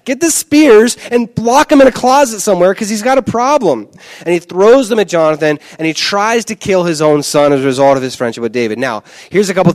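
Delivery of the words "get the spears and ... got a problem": high, louder exasperated rant; fed-up onlooker ranting about the spears; incredulous high exasperated voice; exasperated bystander. Retold or imitated. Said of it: "And you're like".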